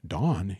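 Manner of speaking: "Don" as a puzzled question, like "Dawn"; misheard as Dawn. Retold or imitated. Wisconsin student questions dorm mate's name, drawn-out "Don". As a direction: query "Don" with a drawn-out vowel. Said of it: "That's a girl's name".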